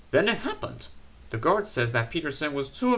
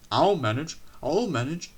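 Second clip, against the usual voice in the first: wheezing; 3 semitones higher than usual. A narrator's reading. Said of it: "he wheezed".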